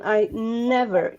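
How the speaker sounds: normal talking voice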